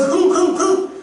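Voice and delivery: high repeated nasal puffs; demonstrated nose-blow in short bursts; imitated nose-blowing. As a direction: mimic a child blowing hard. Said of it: "코가 많이 나온지 몰라요".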